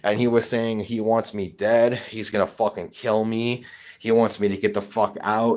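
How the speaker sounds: usual speaking voice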